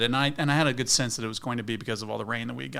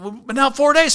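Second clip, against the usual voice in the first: shouted reply; 12 semitones higher, 8 dB louder than usual. Retold or imitated. Own reply across river.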